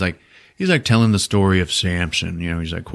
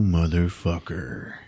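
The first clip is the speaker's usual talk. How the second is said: low quiet curse; 3 semitones lower, 7 dB quieter, slower than usual. Retold or imitated.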